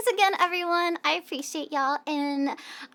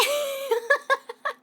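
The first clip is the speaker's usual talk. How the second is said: high giggle; 10 semitones higher than usual. Real, not acted. Laughing after fake accent.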